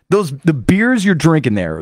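usual speaking voice

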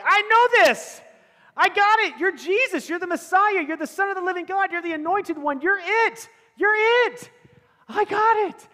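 high, loud, bursting with eagerness; eager excitement. Acted. Peter answering Jesus, bursting with excited certainty. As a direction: blurt it out like a keen student who knows the answer.

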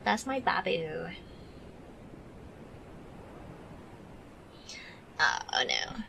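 soft whispered coo. Real, not acted. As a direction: whisper it to the pup. Whispered to pet.

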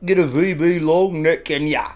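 playful mock Australian accent for the viewers. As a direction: do your best broad Australian greeting. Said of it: "That's my Australian accent".

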